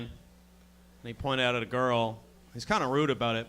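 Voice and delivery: usual speaking voice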